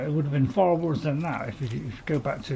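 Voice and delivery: usual speaking voice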